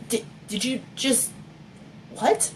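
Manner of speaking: soft, halting "di-, did you just", then "what?" up high; stammering disbelief. Acted. Stammering after seeing the toad.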